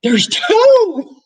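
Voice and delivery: high, loud and thrilled; excited squeal. Retold or imitated. Telling her sister-in-law at the table, a high thrilled squeal. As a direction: blurt it out in giddy disbelief. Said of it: "I'm gonna say, 'There's two.'".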